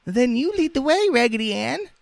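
very high, piping doll cry; French doll crying out. A narrator's reading. Doll urging Raggedy Ann to lead, crying out. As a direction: squeak it out eagerly in falsetto. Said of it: "cried the French dolly".

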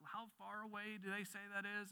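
high, softened voice of amazement; mock wonder. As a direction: marvel quietly at the sheer distance. Acts out wonder at telescope images.

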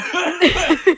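high-pitched burst of real laughter; sudden high giggling fit. Real, not acted.

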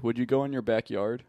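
usual speaking voice

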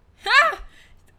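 sudden shrill burst of laughter; loud shrieking laugh. Real, not acted. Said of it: "That was loud".

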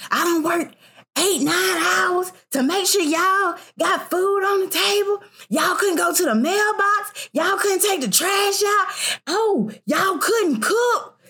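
high-pitched imitation of a fussing mother; mama yelling. Mother home from work scolding kids over chores, imitated yelling. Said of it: "my mama used to yell over the small stuff".

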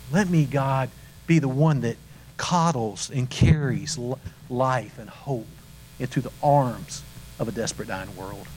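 pleading prayer that sinks to a hush at the end; earnest prayer. Acted. Preacher models a morning prayer for the congregation, pleading then hushed. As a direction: pray it like you mean every word.

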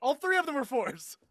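high-pitched, real excitement at a win; excited surprise. Real, not acted. Player announces a lucky dice roll, excited. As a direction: exclaim it high, amazed by your own luck.

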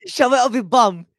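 loud, high mock-English insult; English accent. Echoing the co-host's insult, loud mock-English. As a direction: shout it in hammed-up English. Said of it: "English thing to say".

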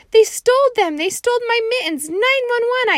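very high, loud frantic shout; hollering. Acted. Junie B. to her teacher, high frantic holler. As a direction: shriek for help like a panicked child. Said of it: "I hollered".

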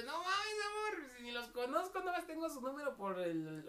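high, crying whine of protest; mock whining plea. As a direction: beg her to believe you. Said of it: "Y yo de". (él suplicando a su esposa, voz aguda y llorosa)

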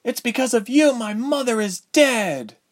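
high-pitched, agitated heroine's outburst; rising indignant cry, very high; crying out in accusation. A narrator's reading. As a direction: hurl accusations in a high girl's voice. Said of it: "Ilse cried".

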